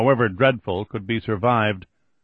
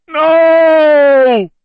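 high, loud terrified scream; 12 dB louder than usual. Acted. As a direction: scream it in terror. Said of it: "The scream was so loud".

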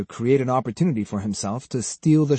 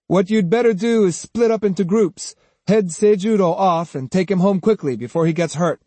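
higher, louder character voice; Kojiro advising; 7 semitones higher, 4 dB louder than usual. A narrator's reading. Swordsman telling the men to take their leader home, advising. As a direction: press your advice on them.

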